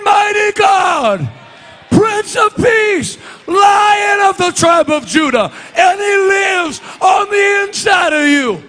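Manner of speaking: high, loud, slow proclamation; booming drawn-out praise; preaching at full force. Real, not acted. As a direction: proclaim each word with full weight.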